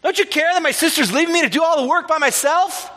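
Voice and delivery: high, fast, exasperated complaint; high, quick, anxious nagging; anxious Martha. Acted. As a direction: whine it at Jesus, fed up with her sister. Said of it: "Martha was anxious".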